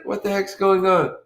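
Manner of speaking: usual speaking voice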